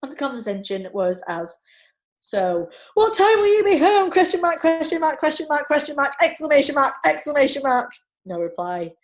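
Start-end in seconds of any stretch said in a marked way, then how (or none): 2.2-8.9 high, loud frantic text reading. Retold or imitated. Texting her husband mid-speech, frantic and high. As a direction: read the messages like you're in a panic. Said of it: "in sheer panic".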